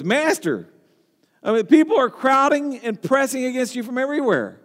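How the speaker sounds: high-pitched, mildly exasperated objection; Peter interrupting. Acted. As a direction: break in, pointing out the obvious. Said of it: "Peter interrupts".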